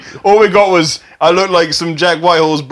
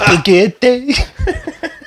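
put-on French accent; 7 semitones higher than usual. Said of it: "accents and impressions".

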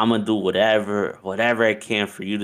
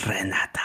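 whispered name after a long pause; hushed build-up to the big reveal; dramatic reveal; 5 dB quieter than usual. Acted. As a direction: drop the name like a soap-opera reveal. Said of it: "let me get a sip of water for this".

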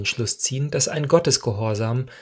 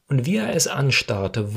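ordinary delivery, nothing marked

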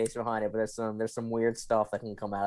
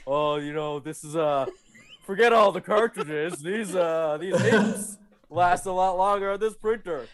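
Shaq impression; 7 semitones higher than usual. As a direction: play it like a big star selling ink. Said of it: "Shaq talking to me about how".

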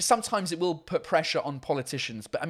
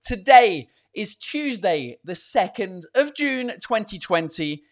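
loud, high hyped-up show intro; show host opener; 5 semitones higher, 5 dB louder, slower than usual. Acted. Host greets live viewers, loud hyped announcer opener. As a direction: kick off the show with big energy.

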